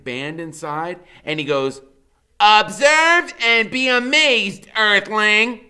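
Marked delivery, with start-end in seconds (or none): 2.4-5.6 high, loud, slow alien-style announcement; small boy showing off his bird, booming like an alien; grand proclamation. A narrator's reading. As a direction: declare it like a spaceman to a lesser being.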